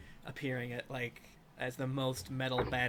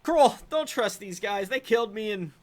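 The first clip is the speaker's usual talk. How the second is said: high, loud undead minion voice; loud, high-pitched warning to the boss; aggrieved ghoul; 7 semitones higher, 8 dB louder than usual. Acted. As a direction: complain at full volume, pitched well up. Second ghoul complains to Bill, Kroll.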